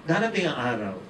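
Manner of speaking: normal talking voice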